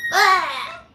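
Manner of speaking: short, high bird squawk; high "ah" mimicking a pet bird; imitated bird squawk. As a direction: imitate a bird's sudden cry. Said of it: "it's not that it's loud. Like, okay, it's like".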